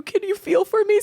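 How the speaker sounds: high pleading voice. Retold or imitated. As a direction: ask it high and timid. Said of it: "I go".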